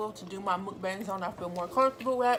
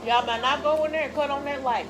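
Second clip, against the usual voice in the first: louder, higher call across the house; 6 semitones higher, 8 dB louder than usual. Real, not acted. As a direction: shout it to the next room. Calls out to people in another room.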